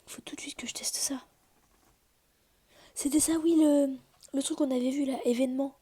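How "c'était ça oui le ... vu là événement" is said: excited. Real, not acted. Finds the event she wanted.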